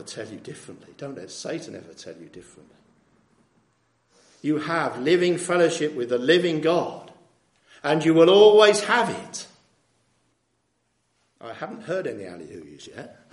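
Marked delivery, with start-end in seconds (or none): 4.4-9.5 rising proclamation. Real, not acted. Preacher climaxes.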